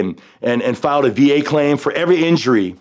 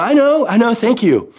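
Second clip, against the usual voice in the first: high, strained voice on the edge of tears; choked-up thanks; 10 semitones higher than usual. Retold or imitated. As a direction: thank her while fighting back tears. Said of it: "the tears started to come out".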